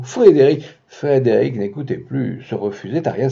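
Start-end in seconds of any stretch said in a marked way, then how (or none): 0.0-0.7 pleading. Acted. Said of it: "supplia Margot".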